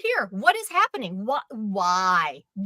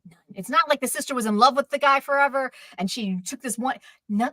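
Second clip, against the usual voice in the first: ordinary delivery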